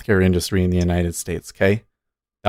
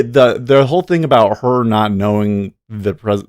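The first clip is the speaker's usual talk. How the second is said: normal talking voice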